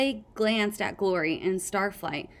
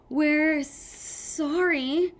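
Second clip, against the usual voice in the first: high, drawn-out nervous stammer; 8 semitones higher, much slower than usual. A narrator's reading. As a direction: falter through a small, high apology. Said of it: "Sunny stammered".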